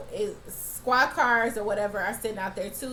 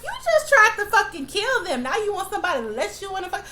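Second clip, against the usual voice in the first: high, loud indignant rant, then faster; outraged; 10 semitones higher, 5 dB louder than usual. Real, not acted. Viewer scolding the cop who begs to be let in, outraged. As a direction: tell the cop off in disbelief.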